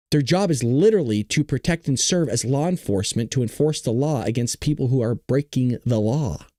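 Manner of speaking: usual speaking voice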